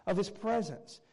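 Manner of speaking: usual speaking voice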